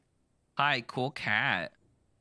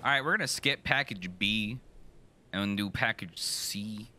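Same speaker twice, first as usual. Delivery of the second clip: ordinary talk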